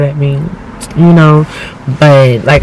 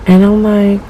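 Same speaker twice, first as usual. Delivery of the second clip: ordinary talk, nothing marked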